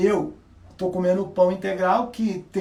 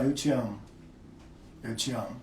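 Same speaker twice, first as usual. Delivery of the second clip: softer and lower, sincere; soft, heartfelt I love you; 8 semitones lower, 7 dB quieter than usual. Shown as a demonstration. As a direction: tell her you love her and mean it. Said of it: "Passa a verdade pra ela".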